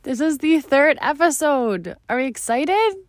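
bright, high, eager greeting; excited. Real, not acted. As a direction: greet the listeners with real excitement. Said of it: "I'm so excited".

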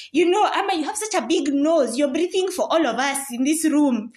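hammed-up playground mockery; mock taunt. Someone mocking Ama's nose, loud teasing.

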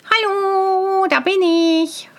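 high, long-held halloo; called out. A narrator's reading. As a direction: sing out your arrival to those ahead. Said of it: "rief Tölpelhans".

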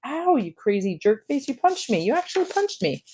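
rising into a high, stunned yelp; shocked outcry. Retold or imitated. Punched boy crying out at Jack in stunned disbelief. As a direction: disbelief at being hit.

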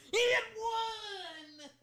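long, high, stretched yell; excited shout. Real, not acted. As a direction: shout it out in delight.

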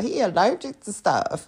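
ordinary talk, nothing marked

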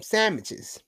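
"sandwiches" said as SAM-itches; slang pronunciation of sandwiches. Shown as a demonstration. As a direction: drop the nd in "sandwiches", use an m.

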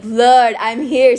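loud, urgent call to the Lord. Retold or imitated. Praying alone in the park, calling out loud.

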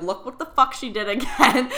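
laughing impression of an annoyed father; mock pissed-off dad. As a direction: imitate an exasperated dad, amused. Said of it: "he would get so pissed at me".

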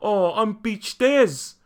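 slow, drawn-out mock New Zealand accent; mock Kiwi accent. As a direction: exaggerate the Kiwi vowels, stretched out. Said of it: "you don't hear people go".

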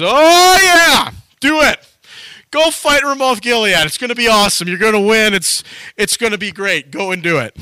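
high, loud mock enthusiasm, easing off; over-the-top fake encouragement; sarcastic. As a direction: cheer them on with obvious sarcasm. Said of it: "he says it sarcastically".

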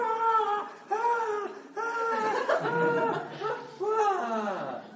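squealing mock-ecstatic yelps; hammed-up ecstatic squeals; high ecstatic cries. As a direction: shriek like a thrilled crowd. Mocking ecstatic crowd.

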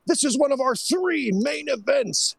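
mock hype. Mocking a promotion's lip service to fans, mock hype. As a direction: parody a promoter pumping up the match. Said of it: "with lip service like".

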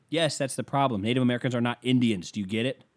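ordinary talk, nothing marked

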